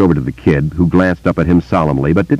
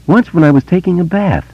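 put-on higher, eager boy's voice; young boy; 13 semitones higher than usual. A narrator's reading. Boy telling Bill about voices, in a higher boy's voice. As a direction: voice a wary small boy telling a secret.

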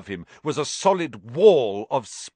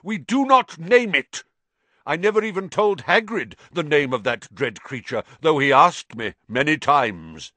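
fierce; 6 semitones higher, 7 dB louder than usual. A narrator's reading. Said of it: "said Aragog fiercely".

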